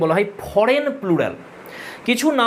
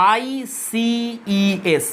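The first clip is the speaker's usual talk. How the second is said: letters of আইসিইএস drawn out one by one; spelled out slowly; much slower than usual. Shown as a demonstration. Teacher spelling the ending for students, very slowly. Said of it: "EX এর বদলে আমি কি বসাবো?".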